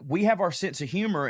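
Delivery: ordinary talk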